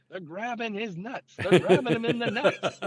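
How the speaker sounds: high mock screaming, laughing through it; a hammed-up high yell at the referees. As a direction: imitate the coach losing it at the refs, half laughing.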